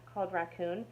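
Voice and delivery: ordinary delivery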